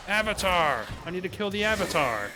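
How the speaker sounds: loud eager battle cry; excited shout. Real, not acted. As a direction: call it out, pumped up. Spotting the boss, calling out.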